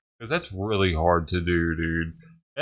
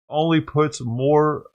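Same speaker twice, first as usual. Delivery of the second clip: ordinary talk, nothing marked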